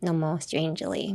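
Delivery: softly, politely fake greeting; insincere smile. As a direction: speak softly with only the mouth smiling. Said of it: "目元あんま笑ってないとき". (目が笑っていない作り笑いで)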